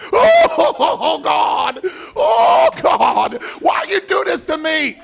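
high, loud crying complaint to God; hammed-up crying shout at God; mock wail. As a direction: wail like the boss just fired you. Fired worker wailing to God.

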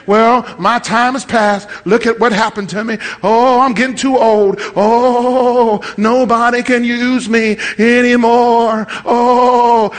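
drawn-out wailing lament; imitating a woeful complainer; mock self-pity. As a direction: moan it like a hammed-up pity party. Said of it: "and you think".

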